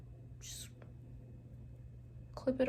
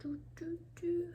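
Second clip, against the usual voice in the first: whispering; 5 semitones higher, 7 dB quieter than usual. Real, not acted. Whispered humming while working.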